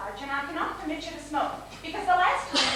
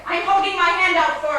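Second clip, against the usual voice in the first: louder, a firm order; insist, hand held out, no more games; stern demand; 3 semitones higher, 10 dB louder than usual. Acted. Nurse ordering Catherine to hand over the cigarette, loud and firm.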